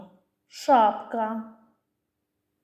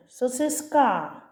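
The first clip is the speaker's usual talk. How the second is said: «Сосиска» stressed on the final а, sosys-KA; wrong stress on last syllable; 2 semitones higher, slower than usual. Shown as a demonstration. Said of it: "«а» буде головним?". (хибний наголос на останнє «а» в слові «Сосиска»)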